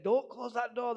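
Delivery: high, insistent retold command; acted urgent shout. Man stopping kids closing the door, urgent retold shout.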